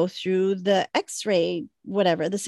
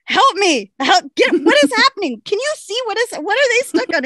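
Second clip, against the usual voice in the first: high, loud, hammed-up pleading; frantic mock pleading up high; retold panic; 11 semitones higher, 5 dB louder than usual. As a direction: beg for help at security, comically frantic. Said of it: "I'm like".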